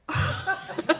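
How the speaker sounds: high, loud real laugh; burst of laughter. Real, not acted. Amused by the boy calling her bluff, real laugh. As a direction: break into a bright laugh.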